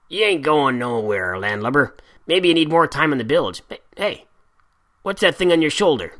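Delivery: block his path with a sneer; menacing pirate. A narrator's reading.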